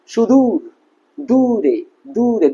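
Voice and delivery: "দূরে" with a long, stretched oo; long vowel. Shown as a demonstration. Teacher showing students the long u sound, long vowel. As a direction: draw out the u in "সুদূর" and "দূরে". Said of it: "দীর্ঘ উকার ব্যবহার করি, তার যে উচ্চারণ হবে".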